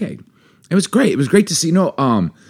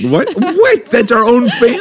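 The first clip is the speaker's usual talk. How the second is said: laughing outrage; 14 semitones higher, 5 dB louder than usual. Real, not acted. Reacting laughing to a name.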